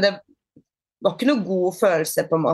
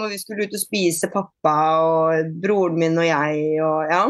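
ordinary talk, nothing marked

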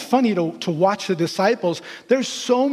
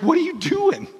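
high incredulous Jesus; 9 semitones higher than usual. Acted. Jesus confronting the disciples.

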